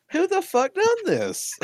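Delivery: high, slow question cracking into laughter; laughing disbelief. Retold or imitated. Retelling the prank's discovery to friends, laughing disbelief. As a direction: act baffled while losing it laughing.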